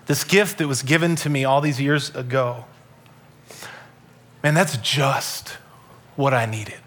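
soft, low, reflective gratitude; hushed, heartfelt thankfulness; humbly grateful. Acted. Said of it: "what if you and me had a posture today".

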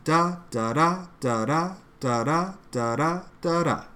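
steady da-DA iambic beat, each da clearly stressed; rhythm in da syllables. Shown as a demonstration. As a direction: tap out the da, da meter evenly. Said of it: "It's there".

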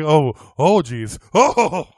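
loud mock-worried moans; worried groaning. Retelling the man whose pee keeps going, worried groans. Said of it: "relief to worry, and then he's like".

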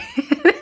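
short high giggle; light laugh. Real, not acted. Laughing at own story.

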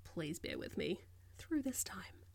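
soft unvoiced whisper. Real, not acted. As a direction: breathe it out quietly. Apologising for background noise.

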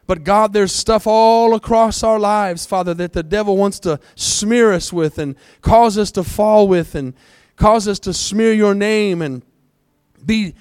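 loud, high fervent prayer; preaching at full force. Real, not acted. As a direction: cry out to God with full force.

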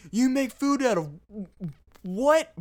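high, loud outraged chef shriek; Gordon Ramsay outraged. Retold or imitated. Disgusted celebrity chef shouting at fast food workers, outraged. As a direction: blow up in disbelief at fast food. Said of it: "he's like".

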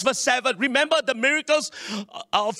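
normal talking voice

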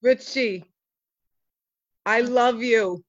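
deep, heartfelt pleading; low and earnest, almost tender; low sincere character voice. Acted. Buster's held low voice.

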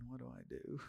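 very soft, retold private thought; dismayed inner thought. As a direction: say it under your breath, as if thinking it. His dismayed thought on seeing them.

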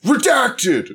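high, loud, drawn-out reveal; mock announcer. Announcing the unnamed guest for next episode, mock announcer shout. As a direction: belt it out like a guest introduction.

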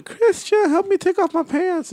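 very high falsetto mimicry; Paco impression. Friend retelling a drunk woman's plea, high-pitched mimicry. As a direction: plead in a squeaky mock voice. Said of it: "and she, like".

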